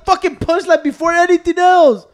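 loud, high, exasperated yelling at his friend; angry outburst. Real, not acted. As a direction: shout him down for spoiling the punchline. Friend ruining his story.

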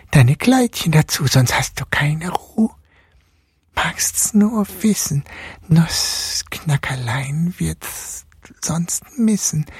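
high, slow whispered threat; hissing mouse king. A narrator's reading. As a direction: hiss menace into her ear. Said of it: "zischte er".

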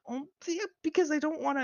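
very high fast whiny excuse; squeaky mock excuse. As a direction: squeak it out mockingly.

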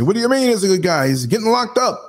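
high, imitated disbelieving challenge; incredulous objection. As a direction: mimic a doubter, pitch climbing as you object. Voices sceptics challenging his judgement.

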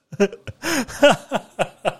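short high burst of laughter. Real, not acted. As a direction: laugh out high at your own joke. Laughs at own joke. (risa aguda y corta)

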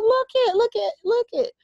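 put-on very high, eager old lady calling a coworker over; excited Southern lady. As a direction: squeal it high like a thrilled grandma. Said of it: "She was this older Southern lady".

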